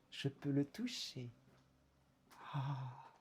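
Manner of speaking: slow, soft, sighing wonder; marvelling visitor. Acted. Stranger discovers your world and can touch it, softly marvelling. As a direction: marvel gently, with long pauses and soft sighs.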